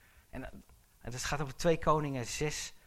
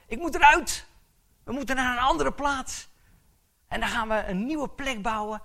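higher, louder urgent preaching; fervent preaching; 8 semitones higher, 8 dB louder than usual. Acted. Preacher urging the congregation to move on, fervent and loud. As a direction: build it up, the walls are closing in.

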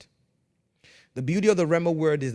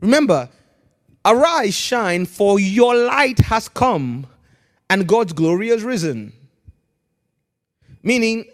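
loud, higher ringing preaching; full-force preaching; 6 semitones higher, 7 dB louder than usual. Real, not acted. Pastor proclaims scripture to the congregation, loud.

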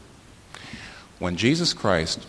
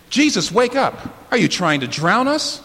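much higher, louder, frantic plea to Jesus; panicked disciples' cry; 12 semitones higher, 6 dB louder than usual. Retold or imitated. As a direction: cry out like a frightened man in the storm. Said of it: "You notice how badly they go?".

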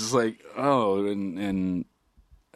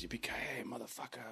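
mock whispered catchphrase; 2 semitones higher, 22 dB quieter than usual. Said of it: "he goes".

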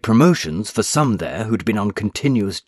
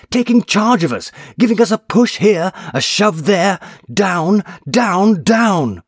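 much higher, louder, desperate shouting; frantic shouting; 12 semitones higher, 6 dB louder than usual. A narrator's reading. Patson warns the doctor in a frantic high shout. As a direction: rant it at full pitch, near panic. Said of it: "Mister Patson shouted".

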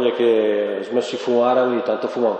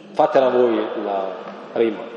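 ordinary talk, nothing marked

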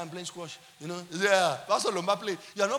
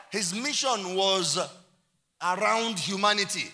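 loud, emphatic pulpit declaration; full-force preaching; 2 semitones higher, 8 dB louder, slower than usual. Real, not acted. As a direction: build to a ringing preacher's cry.

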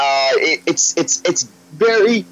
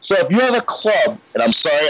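ordinary talk, nothing marked

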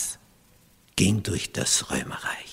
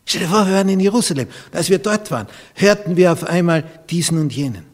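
high-pitched, amazed traveller's report; acted excitement, voice lifted high; excited retelling; 8 semitones higher, 6 dB louder, faster than usual. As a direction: tell astonishing news to the folks back home. Acts out returning pilgrims telling news.

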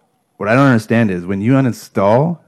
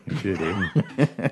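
mock Canadian with laugh; 6 dB quieter, much slower than usual.

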